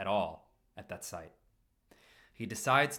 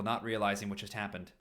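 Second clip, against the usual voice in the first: ordinary delivery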